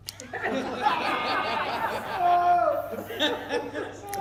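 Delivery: a real high burst of laughter; long high-pitched real laugh. Real, not acted. Speaker laughs at own story.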